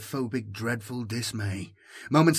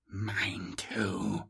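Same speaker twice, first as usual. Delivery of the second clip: soft, sinister disappointed echo; a hushed, malicious echo of the voice; 3 semitones lower, 7 dB quieter than usual. A narrator's reading. Echo haunts the robot's voice, malicious and hushed. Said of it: "A malicious, disappointed echo".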